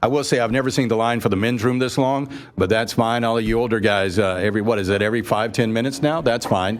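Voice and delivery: ordinary talk, nothing marked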